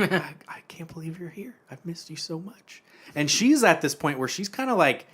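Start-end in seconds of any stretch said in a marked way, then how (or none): 0.0-2.8 soft, breathless whisper, voice raised in pitch. Retold or imitated. Husband in the afterlife greets his wife, whispering. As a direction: whisper it like a tender reunion. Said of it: "and he's like".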